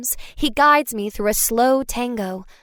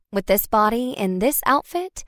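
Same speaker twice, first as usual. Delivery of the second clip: ordinary delivery, nothing marked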